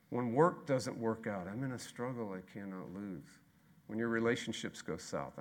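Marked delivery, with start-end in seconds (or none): 1.5-3.4 low, much softer; a low murmured promise; hushed refrain. Real, not acted. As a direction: let it sink almost to nothing. Refrain fading lower.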